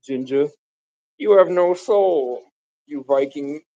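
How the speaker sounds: ordinary delivery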